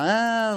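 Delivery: high, loud yelp of pain; retold pained yell. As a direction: yelp as the rat hangs on. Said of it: "like this".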